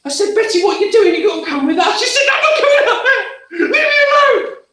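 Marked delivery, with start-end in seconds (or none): 0.4-2.0 higher and louder, pressing her; urgent pleading. Retold or imitated. The narrator urgently begs the maid to follow. Narrator urging Betty to come. 2.3-4.6 much higher, loud, half-laughing refusal; panicky maid. Acted. Betty, frozen on the stairs, refuses in a high panicky voice.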